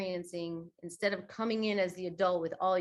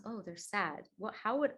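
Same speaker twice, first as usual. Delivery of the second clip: gentle inner thought; 5 dB quieter than usual. Retold or imitated. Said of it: "it becomes".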